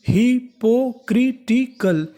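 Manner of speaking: syllable by syllable. Shown as a demonstration. Teacher building hypocritical for students, each syllable separate and slow. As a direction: chop hypocritical into hi, po, kri, ti, kal. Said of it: "तो meaning बनी".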